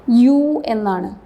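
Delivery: യു said slowly and clearly as "yu", with a y sound at the start; slow pronunciation demo. Shown as a demonstration. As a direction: stretch out യു as "yu" for the class. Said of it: "pronunciation വരുന്നത് എങ്ങനെയാണ്?". (യു എന്ന് സാവധാനം വ്യക്തമായി ഉച്ചരിക്കുക)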